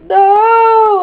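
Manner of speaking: loud, high, drawn-out cry of dismay; retold fright. Retelling her sister's spider bite scare, loud dismayed cry. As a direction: wail it out as a mock panic. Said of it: "I was so scared".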